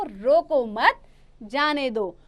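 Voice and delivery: "रोको मत" grouped loudly, clear gap before "जाने दो"; teacher's emphatic reading with the break after मत; pause after मत. Shown as a demonstration. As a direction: say रोको मत together, stop, then add जाने दो. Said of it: "मत के बाद हुआ है".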